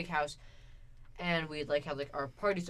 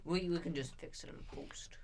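whispering; 8 dB quieter than usual. Real, not acted. Aside about editing.

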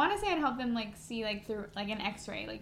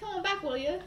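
higher, amused British accent; British accent tipping into laughter; 6 semitones higher, faster than usual. Shown as a demonstration. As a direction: coax in a hammed-up British accent. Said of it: "say that in a British accent".

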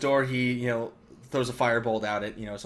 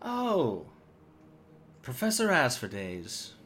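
softer, higher, falsely cordial greeting; mock-friendly devil; 4 semitones higher, 4 dB quieter than usual. Devil greeting Professor Asphodels, falsely cordial. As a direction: greet an old foe with fake charm. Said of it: "the devil turns around and goes".

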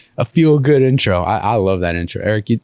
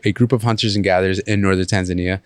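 ordinary talk, nothing marked

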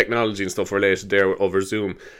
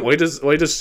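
ordinary talk, nothing marked

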